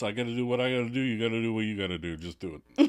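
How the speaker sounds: lightly voiced New York mumble; a touch of New York accent, mumbled. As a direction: toss it off with a hint of New York. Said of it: "New York, mumbles".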